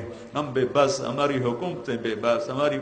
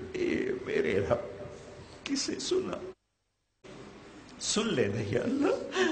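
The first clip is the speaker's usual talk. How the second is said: tearful plea; 6 semitones higher, 6 dB quieter, slower than usual. Real, not acted. Weeping plea to God.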